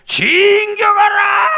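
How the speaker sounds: high, loud, slow battle shout; gruff barked parade orders. Shown as a demonstration. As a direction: bellow the charge with full force. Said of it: "다시 한번 해봅시다".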